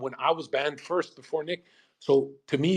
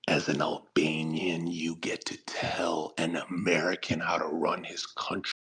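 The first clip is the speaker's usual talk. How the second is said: hushed reasoning; 8 semitones lower, 8 dB quieter than usual. Retold or imitated. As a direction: talk them down quietly. Calm rebuttal to the Albanians.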